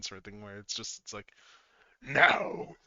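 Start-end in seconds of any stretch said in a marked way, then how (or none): 2.0-2.8 loud, cold villain voice; cold menacing villain. Retold or imitated. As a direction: threaten coldly like a tyrant. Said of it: "menacing, like, just cold and human".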